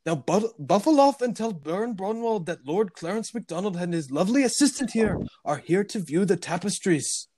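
high, haughty mock-Scottish lord; badly faked Scottish accent. Fake lord dismissing the butler, bad Scottish accent. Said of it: "He adopts a Scottish accent".

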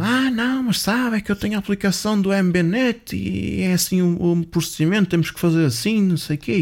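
high-pitched mock protest; put-on high voice rattling off objections; scammer making excuses. Acting the scammer's flustered excuses.

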